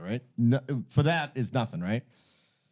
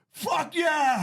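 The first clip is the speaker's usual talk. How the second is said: put-on drunk cheer. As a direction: yell it like a drunk fan at a game. Drunk kids cheering field goal.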